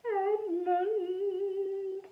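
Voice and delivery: put-on high, slow, eerie ghost-boy call; hollow ghost whisper. A narrator's reading. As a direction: breathe the name like a hollow ghost. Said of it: "the whisper said".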